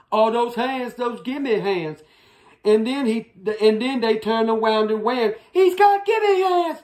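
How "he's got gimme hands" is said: put-on high, scared children's cry; high frightened cry. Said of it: "they cried".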